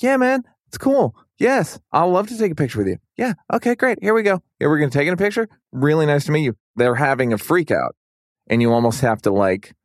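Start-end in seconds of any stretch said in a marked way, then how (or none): 0.0-6.6 much higher, calming reassurance; soothing. Retold or imitated. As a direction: soothe an overexcited fan. Calming down an excited fan.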